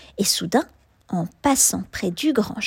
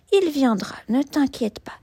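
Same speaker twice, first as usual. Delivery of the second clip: usual speaking voice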